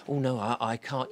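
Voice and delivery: an official's timid, hesitant refusal; shy refusal. Acted. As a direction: decline timidly. Official declines.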